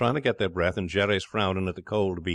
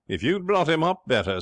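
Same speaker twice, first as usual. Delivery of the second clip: high, raised-voice reproach; severe; 9 semitones higher, 5 dB louder than usual. Acted. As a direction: scold him severely, pitched up. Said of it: "severe to Pa Tuxton".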